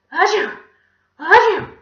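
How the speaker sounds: high, very loud fake sneeze; big theatrical achoo; explosive sneezes. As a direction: sneeze violently, twice over. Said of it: "he began to sneeze".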